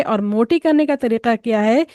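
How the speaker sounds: normal talking voice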